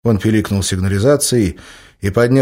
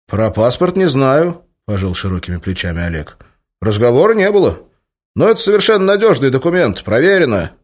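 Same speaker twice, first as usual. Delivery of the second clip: Oleg's voice; 5 semitones higher, 5 dB louder than usual. A narrator's reading. Oleg answering flatly.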